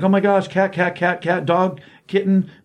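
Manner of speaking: high, squealing excitement over every animal; giddy excitement. Retold or imitated. Melody excitedly rushing at the animals.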